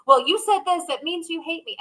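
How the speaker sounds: high whiny voice of a wounded victim; mock victim voice. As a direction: play the hurt one who feels hated. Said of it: "that victim mentality".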